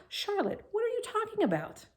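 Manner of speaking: a sudden frightened cry of disbelief; shocked disbelief. Acted. Wilbur shocked by Charlotte's news.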